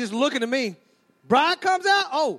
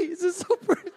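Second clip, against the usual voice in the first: mock weepy lady; 4 semitones higher than usual. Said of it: "y'all start crying at that point".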